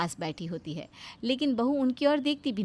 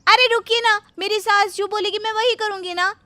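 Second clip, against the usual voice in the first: much higher and louder, insisting; loud shrill protest; 13 semitones higher, 10 dB louder than usual. Acted. Bahu shouting at the friend to let her obey. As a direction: stop them in full voice.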